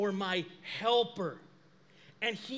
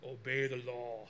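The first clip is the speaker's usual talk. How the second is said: much lower, softer divine voice; God's voice; 11 semitones lower, 7 dB quieter than usual. Retold or imitated. God answering the pastor's protest, low and soft. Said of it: "And I'm like".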